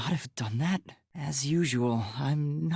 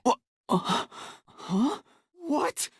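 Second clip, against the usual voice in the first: startled confusion; 13 semitones higher, slower than usual. Acted. Startled awakening in strange place.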